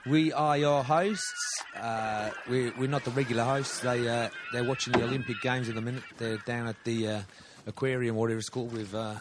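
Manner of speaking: hammed-up Aussie podcast host; put-on Australian accent. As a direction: play a laid-back Australian bloke introducing the show.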